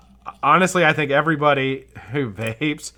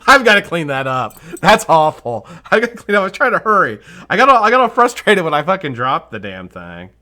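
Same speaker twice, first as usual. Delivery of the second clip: flustered; 8 semitones higher, 9 dB louder than usual. Real, not acted. Flustered after dropping the cap.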